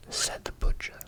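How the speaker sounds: ordinary delivery, nothing marked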